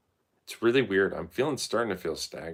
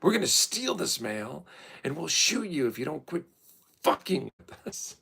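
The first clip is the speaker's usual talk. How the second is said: mock thief threat; 10 semitones higher, 3 dB louder than usual. Mail thieves threatening to shoot, a hammed-up menacing voice. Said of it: "They're like".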